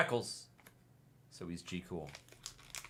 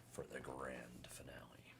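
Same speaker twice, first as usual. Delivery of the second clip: hushed, much softer suspense whisper; 9 semitones lower, 17 dB quieter than usual. Acted. Before opening the last pack, a suspenseful whisper. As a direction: breathe it like a secret reveal.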